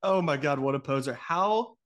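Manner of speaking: ordinary delivery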